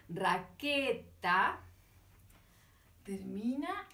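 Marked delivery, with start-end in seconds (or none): 0.1-1.6 raqueta drawn out very slowly, ending -eta stressed; slow, aloud. Shown as a demonstration. Raqueta stretched out so -eta is heard. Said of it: "en voz alta para escucharla".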